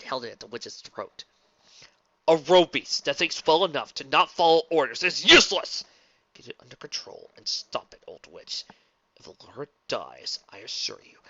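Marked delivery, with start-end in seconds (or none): 2.3-5.8 loud angry outburst; furious. Acted. Prince in fury. 6.3-11.2 quiet menace. Acted. Sword at witch's throat.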